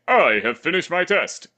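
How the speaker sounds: a hint of heroic All Might. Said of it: "he sounds like All Might".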